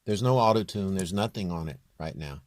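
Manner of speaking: ordinary talk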